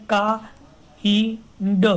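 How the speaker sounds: "ka, i, d" with gaps between; sound by sound. Shown as a demonstration. Teacher counting three sounds in kind, sounded out separately. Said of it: "Only three syllables are there".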